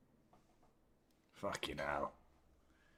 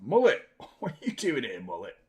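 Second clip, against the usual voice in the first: laughing. Retold or imitated. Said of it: "It's like".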